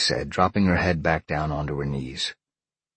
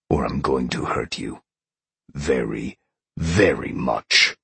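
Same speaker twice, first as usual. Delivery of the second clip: menacing growl; 3 semitones lower than usual. A narrator's reading. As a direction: threaten in a growl, weighing each word. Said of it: "Sean growled".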